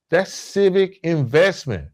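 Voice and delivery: usual speaking voice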